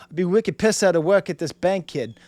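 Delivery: imitation of a Boston local; Boston accent. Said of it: "do an imitation of that accent".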